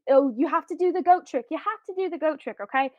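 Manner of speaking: put-on insistent little kid. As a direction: badger them like an eager child. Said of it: "The little kid is telling you".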